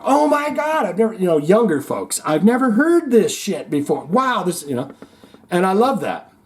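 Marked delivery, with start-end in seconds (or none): 0.0-4.6 high, wide-eyed amazement; amazed young fan. Retold or imitated. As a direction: gush like a kid hearing it first. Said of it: "people in there going".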